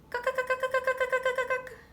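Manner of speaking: very high, loud clucking bird call; sharp woodpecker cucks. Shown as a demonstration. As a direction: bark out each cuck sharply like a woodpecker. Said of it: "Woodpecker calls from a tree".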